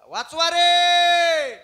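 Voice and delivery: very high, drawn-out shouts for rescue; acted full-force cry for help; screaming for help. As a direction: bellow in panic, stretching each call long. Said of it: "मोठ्यानं ओरडायला लागला".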